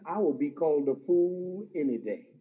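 ordinary talk, nothing marked

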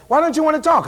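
pressing question. Retold or imitated.

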